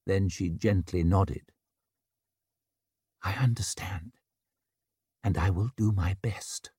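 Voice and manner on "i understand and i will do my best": hushed gentle whisper; barely-voiced, gentle promise. Gentle reply.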